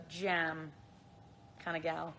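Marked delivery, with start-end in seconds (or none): none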